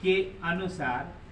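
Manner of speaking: slow, spaced-out dictation; teacher reads out notes for the class to copy, slowly. Acted.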